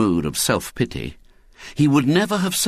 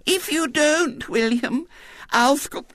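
very high lisping warning; piping childish blackmail; tearful little-girl threat; slower than usual. A narrator's reading. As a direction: threaten slowly, near tears, until cut off. Girl threatens, tears in her eyes.

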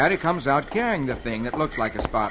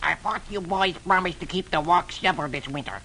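mildly irritated character bark; Donald Duck; 3 semitones higher, 4 dB quieter than usual. A narrator's reading. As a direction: scold the boys in a put-on duck voice. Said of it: "and he barks".

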